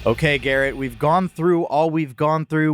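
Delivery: normal talking voice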